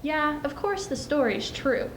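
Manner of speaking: ordinary delivery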